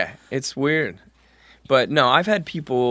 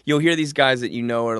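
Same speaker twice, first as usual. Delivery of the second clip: ordinary talk, nothing marked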